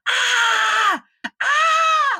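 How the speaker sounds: very high-pitched re-enacted scream; sacrificial scream. Storyteller acting out his terror of the swallow, high scream. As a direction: scream like you're being sacrificed. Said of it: "I start screaming like I'm being sacrificed".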